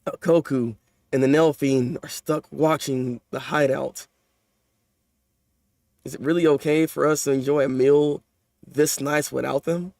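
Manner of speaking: mildly voiced anxious friend asking about the meal; low, slow hint of a fretful character; lightly voiced manga character. Reads manga character lines.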